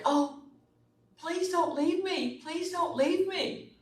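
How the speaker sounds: high, slow, pleading plea to a departing man; pleading woman. Retold or imitated. Woman in the picture begging the man to stay, high and pleading. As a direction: beg him not to go, voice lifted high. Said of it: "she's looking like".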